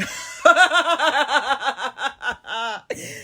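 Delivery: long rising witchy cackle; witch laugh. Real, not acted. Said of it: "witch laugh".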